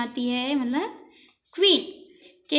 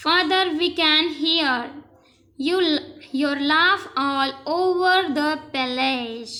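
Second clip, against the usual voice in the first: slow read-aloud; much slower than usual. Shown as a demonstration. Teacher reads princess's line slowly.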